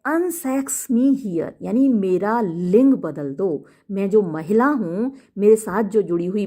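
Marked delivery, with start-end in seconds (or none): 0.1-1.5 slow, dramatic "Unsex me here", drawn out; Lady Macbeth voice. Shown as a demonstration. Teacher reading Lady Macbeth's plea, slow and dramatic. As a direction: call on the spirits slowly. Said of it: "तो वो कहती है".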